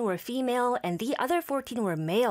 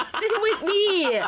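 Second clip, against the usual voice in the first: laughing, slow and higher; 10 semitones higher, 4 dB louder, much slower than usual. Real, not acted. Admitting her accent is from Harry Potter, laughing. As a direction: laughs through the words. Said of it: "laughing at her accent".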